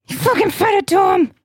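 high, fast exasperated shout; real heated outburst. Real, not acted. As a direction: blurt it out, high and incredulous. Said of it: "sorry, I'm getting heated".

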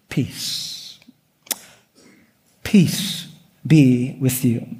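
very soft, slow, almost whispered Peace; tender blessing. Acted. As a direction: hush it down like a gentle blessing. Jesus' gentle answer to betrayal.

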